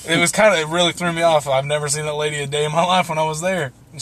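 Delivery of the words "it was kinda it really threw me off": usual speaking voice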